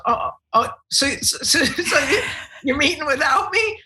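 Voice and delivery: high, stammering, wounded whine; mock hurt co-host. Imitating co-host Bo hearing he is left out, high hurt stammer. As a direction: play the left-out partner, high and rattled. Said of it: "And he goes".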